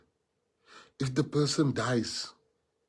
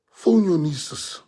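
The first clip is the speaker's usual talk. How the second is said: emphatic call; 6 semitones higher, 9 dB louder, slower than usual. Real, not acted. Rising exhortation to the crowd.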